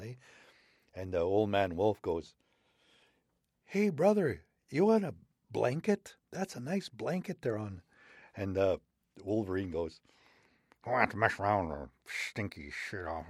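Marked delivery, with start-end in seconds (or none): 3.6-7.8 high sly offer; old man wolf. Acted. Old man wolf tempting wolverine, high sly voice. 10.8-13.2 grumbling wolverine. Acted. Wolverine scoffs.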